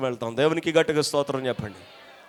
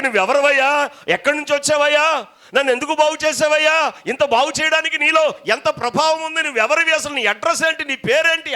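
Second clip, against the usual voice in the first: eager questions; 10 semitones higher, 9 dB louder than usual. Retold or imitated. Healed man should ask Jesus.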